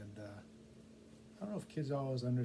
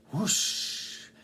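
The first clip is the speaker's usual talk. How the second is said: whoosh sound; 6 semitones higher, 9 dB louder than usual. A narrator's reading. Curtain falls with a sound.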